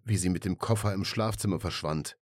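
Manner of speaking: usual speaking voice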